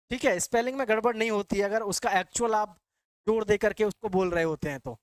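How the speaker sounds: usual speaking voice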